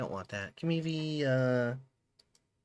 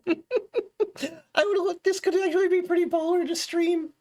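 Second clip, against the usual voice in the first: high laughing voice; 12 semitones higher than usual. Acted.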